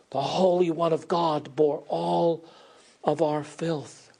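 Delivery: ordinary talk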